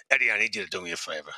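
low, quick captain impression; George Duke. Detective prank-calling Eddie as the captain, low imitation. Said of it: "the best time he ever imitated him".

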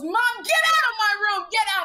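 high, loud mock teenage yell; imitating bratty teen daughter. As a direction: shriek it like a bratty daughter kicking mom out. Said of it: "She goes".

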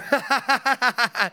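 high, put-on burst of laughter; retold laughter. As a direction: imitate laughing to get attention. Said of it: "I started just laughing and going".

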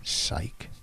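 barely audible whisper; whispered aside. Real, not acted. Golfer to co-host after arguing, whispered aside. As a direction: slip it in under your breath.

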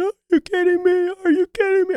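very high, loud excited squeal; mock high-pitched scream of a thrilled hunter; imitating a screaming buddy. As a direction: squeal it out like he just shot a buck. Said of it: "I hear him just screaming".

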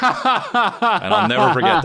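high, laugh-filled line that breaks up into giggles. Real, not acted. Cracking up at his own story.

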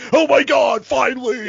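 high, gushing mock relief in a put-on voice; mock excited broadcaster. Host mocking the TV broadcaster's fake excitement, high gushing put-on voice. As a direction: lampoon the announcer finally getting his thrill. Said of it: "it's like".

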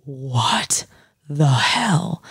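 stunned whisper; whispered shock. Retold or imitated. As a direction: breathe it out in disbelief, no voice. Friend reacts to ruined dress.

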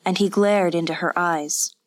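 ordinary delivery

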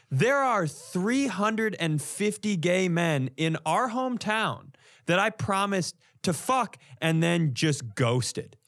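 ordinary talk, nothing marked

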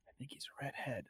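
soft breathy whisper, no voice; whispered aside. Real, not acted. A podcaster whispers a joke aside to his cohosts, in a whisper. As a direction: lean in and whisper it like a secret. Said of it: "whispered aside about a redhead".

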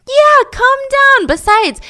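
high, loud, drawn-out brush-off; mock-casual dad. Father brushing off his worried son, high and dismissive. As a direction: wave the worry away grandly.

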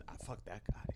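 low, barely voiced whisper; hushed, confiding dismissal; muttered aside. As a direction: drop it under your breath, off the record. Acted testimonial, muttered aside about coach.

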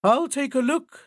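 high, loud owl voice; eager owl volunteering. Acted. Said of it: "said the owl".

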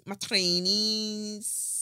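Scouse accent. Shown as a demonstration. Host showing listeners her trainers, mock Scouse accent. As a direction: stretch it out in a put-on Scouse accent. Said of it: "that's my Scouse accent".

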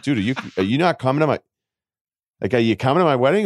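knowingly bad Boston accent, quick and high. Friend asks if he's skipping the wedding, in a bad Boston accent. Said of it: "I'm doing, like, a bad one right now".

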